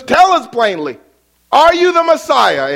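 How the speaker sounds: demanding crowd. Retold or imitated. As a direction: press him for an answer, loud. Preacher voices the crowd demanding.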